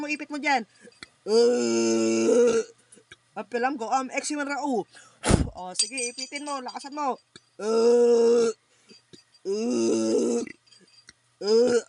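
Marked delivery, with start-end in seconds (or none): 1.2-2.6 loud groaning grunts. Real, not acted. Healer grunting loudly over the patient in trance. 7.6-11.8 loud groaning grunts. Real, not acted. Healer grunting loudly over the patient in trance.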